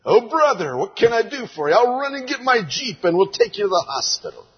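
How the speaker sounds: high, fast, alarmed offer of help; frantic American helper. Retold or imitated. Preacher retells rushing to help asthmatic brother, frantic. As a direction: rush the words out, panicked and eager. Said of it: "I reacted as an American might react. I said".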